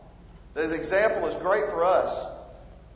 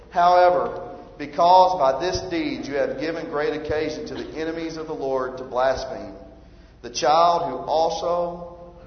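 scripture reading; 2 semitones lower than usual. Acted.

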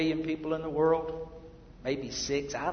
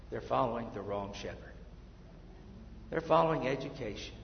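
ordinary talk, nothing marked

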